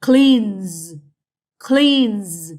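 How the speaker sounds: cleans, loud, with a long z ending; cleans, said strongly as cleanzzz; drawn-out final Z. Shown as a demonstration. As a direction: stretch cleans out into a buzzing z. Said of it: "we say".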